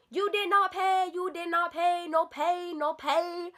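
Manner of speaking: high, slow, insistent chant; mock her demand, stretched out and high; mimicked salon worker. Teller imitating the salon worker demanding payment, mocking mimicry.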